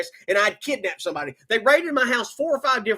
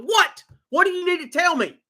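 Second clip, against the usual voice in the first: higher, urgent what; frightened pitch-raised questioning; alarmed demand; 6 semitones higher than usual. Retold or imitated. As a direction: demand the news in a rush of worry. Panicked demand to the cop.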